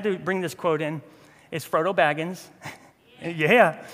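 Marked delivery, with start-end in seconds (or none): none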